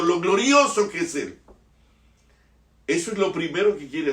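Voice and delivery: loud, high preaching at full force; fiery preaching. Acted. Preacher to the congregation about knowing the Father, fiery. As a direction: thunder the majesty of the Father.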